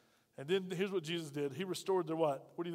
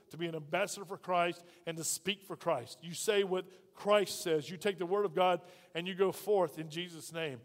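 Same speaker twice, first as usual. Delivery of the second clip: ordinary delivery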